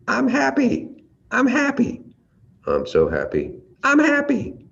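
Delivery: very high, squealing "I'm happy"; gleeful squeal. Shown as a demonstration. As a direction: gush with delight. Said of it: "the tone is so completely different".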